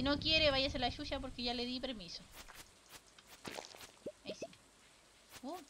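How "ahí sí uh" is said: ordinary delivery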